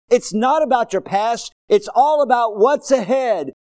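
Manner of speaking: loud, high, impassioned pulpit shout; full-force preaching. Real, not acted. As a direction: drive each phrase home at the top of your voice.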